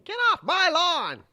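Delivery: high, loud cranky-geezer shout; hammed-up senator-as-grouch bellow; old man yelling. Sanders on his porch yelling at trespassers, high loud mock shout. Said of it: "outside on his porch yelling".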